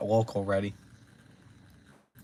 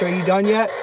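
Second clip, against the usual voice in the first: impatient loud; 7 semitones higher, 5 dB louder than usual. Real, not acted. Repeats the question louder.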